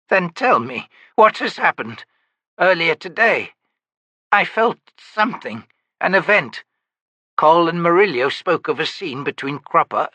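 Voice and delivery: put-on high, strained pleading voice of a frightened old woman; anguished elderly mother, pitched well above the narrator; Myby, desperate old woman. A narrator's reading. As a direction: beg to be watched over, voice cracking up high. Myby demanding answers.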